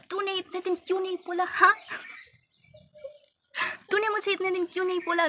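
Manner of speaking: high, scolding mom voice; a retold mother's sharp scolding. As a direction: imitate mom demanding why she hid it.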